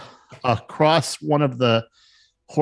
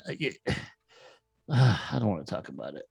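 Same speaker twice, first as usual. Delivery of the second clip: weary dwarf; 3 semitones lower, 7 dB quieter, much faster than usual. Acted. Weary dwarf brushes off question.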